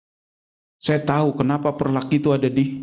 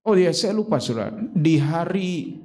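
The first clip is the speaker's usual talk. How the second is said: ordinary talk, nothing marked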